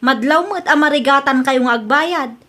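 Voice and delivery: usual speaking voice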